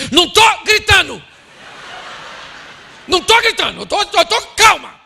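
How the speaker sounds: high, loud, indignant acted yell; shouting denial. Wife denying to husband that she shouts, yelling. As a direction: yell that you are perfectly calm. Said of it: "Ela tá gritando".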